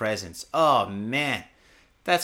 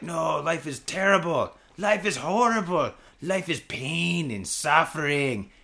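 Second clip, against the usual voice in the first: gloomy mom and grandma; slower than usual. Pretend. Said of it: "maybe they're like".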